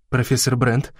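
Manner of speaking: ordinary delivery, nothing marked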